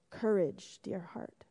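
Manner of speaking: soft, fading to a near whisper. A narrator's reading. Albatross whispering to Lucy, soft and tender. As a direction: breathe it gently into her ear. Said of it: "it whispered in Lucy's ear".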